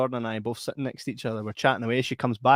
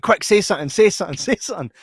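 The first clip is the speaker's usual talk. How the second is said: mock panic; 6 semitones higher, 8 dB louder than usual. Panicked inner voice.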